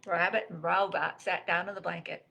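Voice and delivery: ordinary delivery, nothing marked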